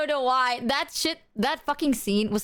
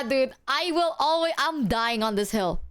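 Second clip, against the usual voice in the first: ordinary delivery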